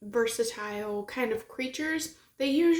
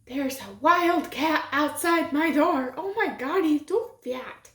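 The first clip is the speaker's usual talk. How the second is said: excited; 5 semitones higher, 5 dB louder than usual. Real, not acted. Spots cat outside door.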